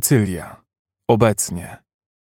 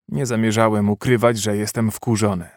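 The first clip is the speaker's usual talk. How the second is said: ordinary delivery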